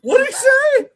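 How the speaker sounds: high laughing squeal. Real, not acted.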